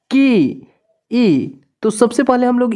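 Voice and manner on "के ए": के said first, then its vowel ए pulled out on its own; sound split. Shown as a demonstration. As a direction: say के, then isolate ए. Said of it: "के बोलने पर ए की sound". (के बोलकर फिर ए की ध्वनि अलग से)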